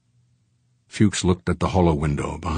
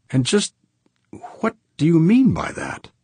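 much higher woman's character voice; calm challenge; 11 semitones higher than usual. A narrator's reading. Businesswoman questioning a murder accusation, calm and high-pitched. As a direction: question coolly in a raised pitch. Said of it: "she asked calmly".

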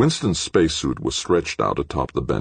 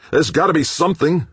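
much higher, loud insistence; insisting; 6 dB louder than usual. A narrator's reading. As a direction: push it hard, no room for doubt. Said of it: "McClintock insisted".

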